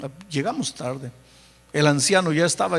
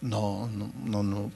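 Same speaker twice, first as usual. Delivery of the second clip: much softer, low stubborn no; hushed refusal; 8 semitones lower, 10 dB quieter, slower than usual. Retold or imitated. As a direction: mutter the refusals under your breath. Pastor refuses the inner voice. (pastor se niega en voz baja y grave)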